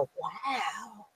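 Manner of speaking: hushed, imitated wow; awed staring onlooker. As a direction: breathe it out very softly, mimicking awe. Voicing people staring at her.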